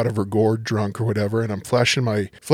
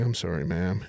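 low, soft and chastened; small, dropped voice, mock contrite; meek apology; 6 semitones lower, 9 dB quieter than usual. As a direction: imitate yourself apologizing sheepishly. Retold meek apology after scolding.

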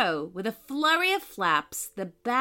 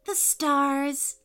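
put-on higher, lightly happy child character; happy little girl; 5 semitones higher than usual. A narrator's reading. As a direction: answer with a touch of childlike delight. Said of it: "said Poppy happily".

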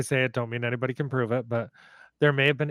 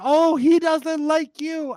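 much higher, louder, slow teasing tone; amused grandma; 13 semitones higher, 9 dB louder, slower than usual. Retold or imitated. Grandma laughs as bird chases narrator, high teasing. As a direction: tease her while laughing. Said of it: "Graham is laughing".

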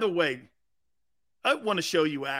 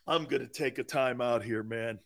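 ordinary talk

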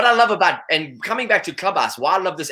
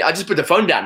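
ordinary talk

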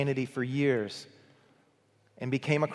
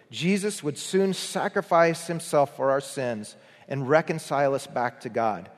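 usual speaking voice

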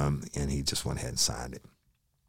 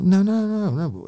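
much higher, louder frantic no's; panicked protest; 7 dB louder than usual. Retold or imitated. Suspect begging not to be cuffed, panicked protest. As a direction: plead in sudden panic.

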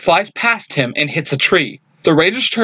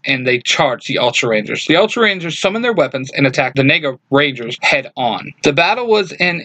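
ordinary delivery

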